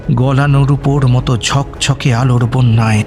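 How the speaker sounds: plain reading voice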